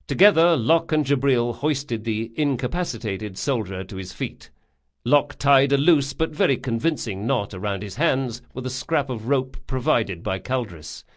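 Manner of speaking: ordinary delivery, nothing marked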